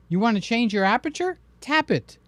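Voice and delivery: ordinary delivery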